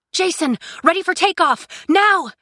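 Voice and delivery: high, urgent shout; yelling. A narrator's reading. Cinder to the ship's pilot before escape, yelling. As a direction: yell the order across to the ship. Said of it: "Cinder yelled toward the ship".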